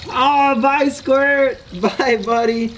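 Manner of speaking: high, fond farewell to a pet; affectionate goodbye. Real, not acted. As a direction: coo goodbye to the little turtle. Saying goodbye to the turtle.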